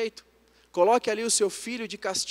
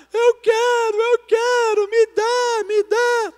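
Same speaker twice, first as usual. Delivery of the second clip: loud, high-pitched toddler tantrum imitation; crying child; 9 dB louder, much slower than usual. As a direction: mimic a wailing child, every word cried out high. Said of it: "começou a chorar".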